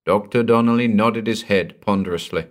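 ordinary delivery, nothing marked

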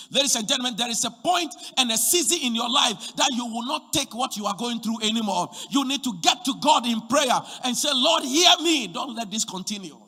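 loud, high pleading sermon; shouting pastor at the climax; fiery preaching. Real, not acted. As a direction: drive it home with full force. Preaching climax, acted prayer.

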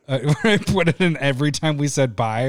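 usual speaking voice

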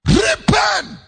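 repent shouted high and loud. Acted. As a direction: thunder the call to repent. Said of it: "So Jesus said".

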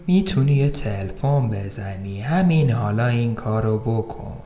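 soft, slow cop's permission; quiet offer. Acted. Cop tells the prisoner to make his call, soft and slow.

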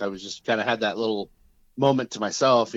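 normal talking voice

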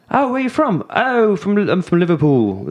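high, mock British accent; a playful tourist fakes a British accent in Epcot. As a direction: put on a hammed-up British accent. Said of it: "in your British accent".

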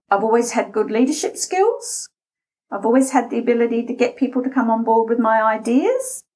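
each statement ends high like a question, on "skills" and "ideas"; rising intonation. Acted. Job candidate naming strengths to interviewer, "skills" and "ideas" rising like questions. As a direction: swing up sharply on "skills" and "ideas", questioning your own claims. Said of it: "You are questioning your own content".

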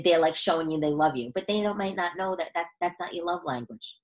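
usual speaking voice

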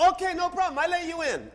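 high-voiced, obliging local man. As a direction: imitate an eager local, pitched well up. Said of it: "and the man goes".

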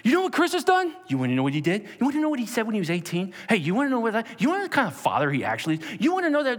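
high, loud taunting accuser voice; mocking accuser. Accuser taunting the sinner before the Sanhedrin, high and loud.

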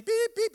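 high, loud double beep; sharp beeping sound; imitated oven beep. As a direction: chirp it like the timer.

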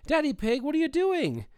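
high-pitched, scolding cartoon mother; cartoon wife. Retelling a cartoon wife catching her husband at home, high-pitched. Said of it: "the wife comes running out".

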